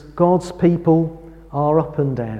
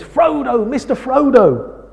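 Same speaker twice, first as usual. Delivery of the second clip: high call across the river, retold; calling after a friend from the bank; 8 semitones higher than usual. Sam calls to Frodo across the river, a retold high call. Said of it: "he calls to Frodo".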